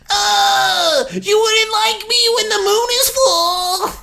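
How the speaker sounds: high, slow howling werewolf. Retold or imitated. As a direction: wail like a werewolf. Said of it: "it's like this voice plays".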